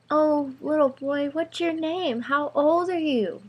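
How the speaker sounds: put-on high-pitched, sweetly condescending girl; playful girl. A narrator's reading. Girl questioning the little boy, playfully sweet. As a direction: coo down at a little kid in a high voice. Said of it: "acting completely different from the time before".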